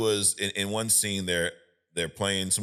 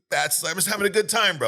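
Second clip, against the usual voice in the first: much higher, jovial retelling; high-pitched mock joviality; yucking it up; 10 semitones higher, 3 dB louder than usual. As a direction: imitate a guy joking around at someone's expense. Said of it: "he's yucking it up at him".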